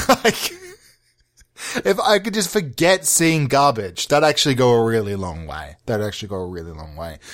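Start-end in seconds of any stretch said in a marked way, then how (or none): 5.9-7.2 low, quick, under the breath; muttered repeat. Real, not acted. Comedian repeating to himself about forgetting bad films, quiet mutter. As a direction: drop it right down and mutter it to yourself.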